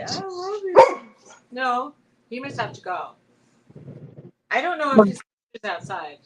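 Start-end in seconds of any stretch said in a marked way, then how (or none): 0.0-1.0 high, loud doggy croon; talking dog. Shown as a demonstration. Owner telling her dog what to say, high doggy voice.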